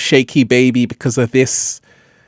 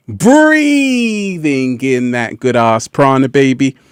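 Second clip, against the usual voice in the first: slow savoring drawl; slower than usual. Acted. Savoring breath.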